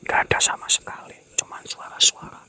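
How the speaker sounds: fast hushed aside; whisper. Real, not acted. Whispering to viewers that only voices are heard. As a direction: keep it down, the place is listening.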